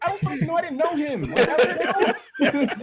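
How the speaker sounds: high-pitched, exasperated retold protest; flustered protest. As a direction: plead ignorance in a high, loud voice. Protesting to accusers in story.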